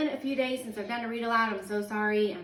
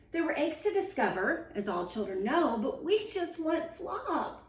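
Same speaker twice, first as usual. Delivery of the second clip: put-on higher, slow, pleading children; pleading cry; 7 semitones higher than usual. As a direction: beg to have Flop back. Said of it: "cried May and cried Joe".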